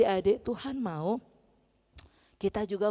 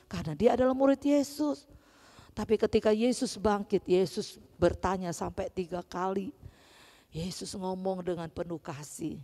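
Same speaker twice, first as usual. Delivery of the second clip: ordinary talk, nothing marked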